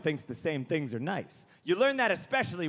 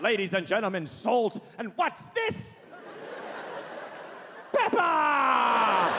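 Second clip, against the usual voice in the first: stagey announcement leaping up into astonishment, then a long shout; hammed-up theatrical surprise; Patrick Stewart; 4 semitones higher, slower than usual. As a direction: build to an amazed, booming cry. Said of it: "like he was surprised by pepper".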